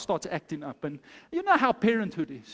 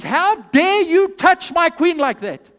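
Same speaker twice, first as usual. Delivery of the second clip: high, loud, slow roar of indignation; upset, outraged king; 6 semitones higher, 8 dB louder than usual. Acted. King finds his queen being grabbed, loud outrage. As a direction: thunder it at the offender. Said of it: "He gets upset and he says".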